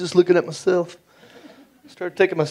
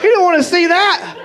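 high, loud horrified telling; telling what dad saw, high and loud; 12 semitones higher, 3 dB louder than usual. Acted. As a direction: squeal it like you can't unsee it. Dad's horror told.

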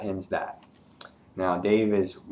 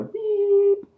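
censor bleep; 7 dB louder than usual. Acted. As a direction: beep squeaked far higher, louder and slowed. Reading censored comic caption.

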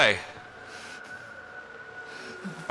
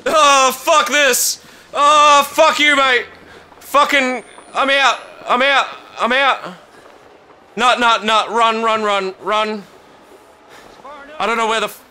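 high, loud panicked yelling; being chased; 9 semitones higher, 8 dB louder, slower than usual. Real, not acted. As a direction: scream it while fleeing for your life. Fleeing enemies in game.

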